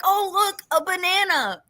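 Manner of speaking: playful high imitation of a thrilled kid; imitating excited child. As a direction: pipe up in pretend wonder at a small thing. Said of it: "she gets excited at everything".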